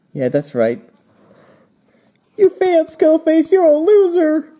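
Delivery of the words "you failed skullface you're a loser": very high, loud mocking squeal; jeering taunt. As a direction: rub it in with a high-pitched jeer. Acted taunt at the villain.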